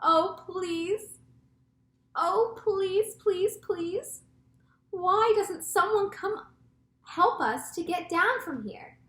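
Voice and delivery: high-pitched pleading call. A narrator's reading. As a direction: beg for rescue from a height. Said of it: "Miss Spider called out".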